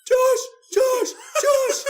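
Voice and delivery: high, frantic shouts for a brother; retold scream for help; panicked yelling. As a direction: yell the name in alarm, very high.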